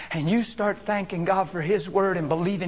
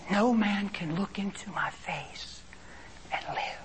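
slow voiceless whisper; 9 dB quieter than usual. Retold or imitated. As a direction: lean in and whisper it slowly. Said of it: "And He whispered".

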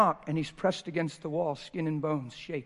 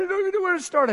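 very high, strained son's plea; son's high broken voice; 8 dB louder, much faster than usual. Retold or imitated. Son at the door pleads with his father, high and strained. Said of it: "He said".

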